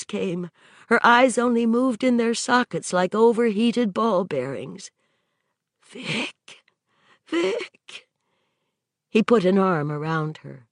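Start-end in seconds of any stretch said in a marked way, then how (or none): 5.8-8.1 weak breathy whisper; faint whisper. A narrator's reading. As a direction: barely voice the name. She can barely whisper.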